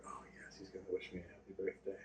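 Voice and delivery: hushed, breathy sarcastic aside; mock whisper. Host mocking the son's hope of a birthday call, whispered. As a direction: whisper it like a hopeful secret. Mocking friend's hopeful reply.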